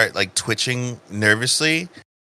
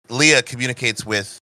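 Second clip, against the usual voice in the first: ordinary delivery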